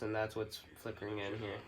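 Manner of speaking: normal talking voice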